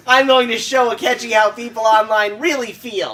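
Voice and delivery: high nerdy fanboy, laughing. Acted. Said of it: "I gotta give him a really nerdy voice".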